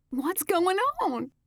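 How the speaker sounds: high, slow, loud question; struggling bride. A narrator's reading. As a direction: ask it while struggling upright. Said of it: "she struggled to sit".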